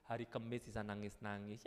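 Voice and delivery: ordinary talk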